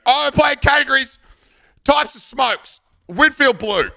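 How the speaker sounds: high, loud mock announcement; imitating Matt's call-out. Matt kicks off a categories game, high loud call-out. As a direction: belt it out like a rowdy game opener. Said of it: "he always starts the exact same way".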